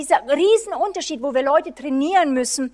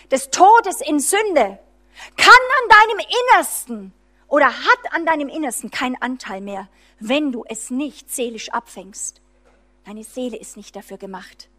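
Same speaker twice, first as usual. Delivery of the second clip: ordinary talk, nothing marked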